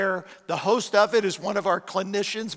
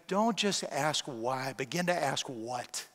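much softer, lower and slow; gentle inner voice; 6 semitones lower, 9 dB quieter, slower than usual. Retold or imitated. The Holy Spirit answering the pastor, soft and slow. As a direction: let the answer come in a hush.

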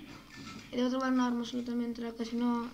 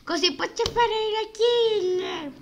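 put-on voice; 9 semitones higher, 7 dB louder, slower than usual. Excited gamer talk.

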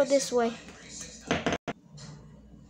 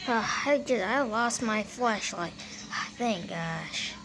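ordinary delivery

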